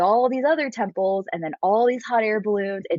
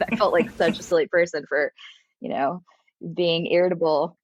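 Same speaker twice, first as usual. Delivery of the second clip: usual speaking voice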